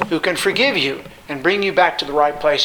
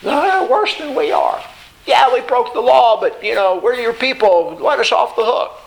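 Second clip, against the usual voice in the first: self-justifying plea; 7 semitones higher than usual. Retold or imitated. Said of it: "that's kind of what they were thinking".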